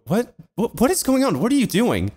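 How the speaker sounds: high-pitched mock confusion; retold bafflement. Storyteller replays questioning his departing friend, baffled.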